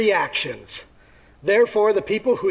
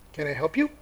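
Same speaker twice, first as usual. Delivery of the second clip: skeptical gatekeeper; 3 semitones lower, 5 dB quieter, faster than usual. Acted. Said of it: "gonna be something like this".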